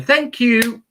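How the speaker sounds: lightly acted, high-pitched thank you; eager snake energy. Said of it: "it's going".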